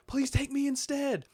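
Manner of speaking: high, loud pleading shout; impassioned, urgent begging; desperate plea. Acted. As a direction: cry out, offering yourself in his place. Acting out Batman's plea, then copied.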